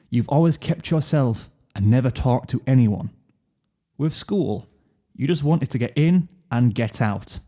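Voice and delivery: plain reading voice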